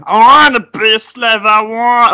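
slow, high put-on Scottish accent; Scottish accent impression. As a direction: drawl it like a Scottish singer. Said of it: "That was quite good".

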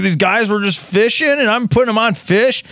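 high-pitched imitation of a rowdy drunk; retold drunken yelling. Wasted fishing guide bragging to his bar, imitated yelling. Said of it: "just yelling, screaming, just loud as hell".

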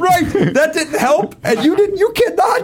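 high, laughing near-shout; cracking up, voice squeaking high. Real, not acted. Laughing outburst.

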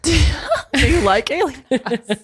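slow, laughing question. Pretend. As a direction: laugh it out slowly.

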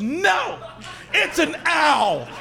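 high, drawn-out mock shriek; child's tantrum. Girl rejecting the owl cake pop, mock tantrum. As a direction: imitate a kid melting down, stretching the words. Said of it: "And the girl loses her mind".

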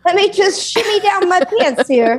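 mock-helpful patient voice; compliant patient. Plays compliant drive-through patient.

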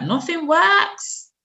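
mild high, slow tearful wail; half-sob the words, drawn out and slow; crying. Retold or imitated. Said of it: "I started crying".

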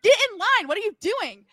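high, loud frantic order; frantic yell. Retold or imitated. Daughter ordering her mom back into line, frantic yell. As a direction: bark it at your mom. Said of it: "I'm like".

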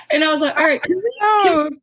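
high laughing cry; words breaking up in laughter; real laughter, high and loud; laughing hard. Real, not acted. Laughing at friend's flat no.

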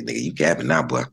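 put-on low, drawled old-timer teasing; old man's voice. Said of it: "he sixty, he say".

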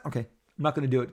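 ordinary talk, nothing marked

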